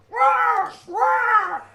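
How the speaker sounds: loud high crow caws. Acted. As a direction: caw like a big crow. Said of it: "as a crow".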